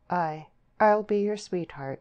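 ordinary delivery, nothing marked

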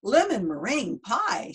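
slow, high, swooping exclamation; delighted exclamation. Acted. Missus Rogers tastes the dessert and exclaims, delighted exclamation. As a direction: draw it out with rising pitch. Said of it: "she exclaimed".

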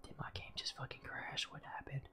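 hushed unvoiced whisper. Real, not acted. Streamer whispering so the game won't hear. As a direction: keep it to a breath. Whispering to keep mic low.

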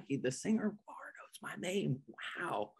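awed, hushed whisper; barely voiced wonder; starstruck whisper. Real, not acted. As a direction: whisper it in disbelief. Starstruck that idol knows him.

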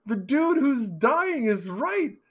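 yelling at the TV in mock outrage; worked-up, drawn-out shout at the screen; steamed. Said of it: "I was getting all steamed".

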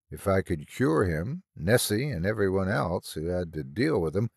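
plain reading voice

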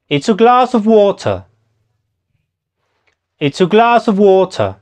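"It's a glass of water" lilting up on glass, falling on water; sing-song "It's a glass of water" with weak "a" and "of"; musical English intonation. Shown as a demonstration. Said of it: "Tiene un tonito como musical".

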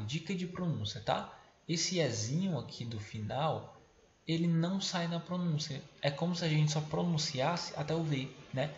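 usual speaking voice

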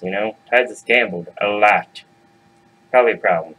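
ordinary delivery

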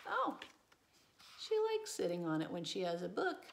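ordinary delivery

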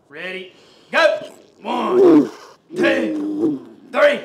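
very loud, drawn-out coaching count; full-effort count. Real, not acted. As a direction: shout the count to drive the athlete. Repeat count at hundred percent effort.

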